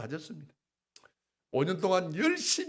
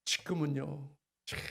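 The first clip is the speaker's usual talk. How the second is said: usual speaking voice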